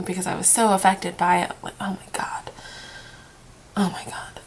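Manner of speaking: whispered through tears, barely voiced; choked-up hush after bawling; tearful whisper. Real, not acted. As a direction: breathe it out while crying. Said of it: "as I was bawling".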